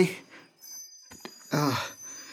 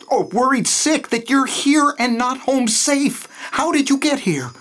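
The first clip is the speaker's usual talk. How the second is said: high, agitated concern; anxious and urgent; worried; 9 semitones higher than usual. Acted. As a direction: voice climbing with worry. Anxious at fiancée being captive.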